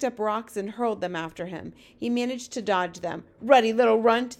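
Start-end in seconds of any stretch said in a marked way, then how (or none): 3.4-4.4 loud giant's jeer; shouted insult. Acted. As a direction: hurl the insult loudly. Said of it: "they shouted".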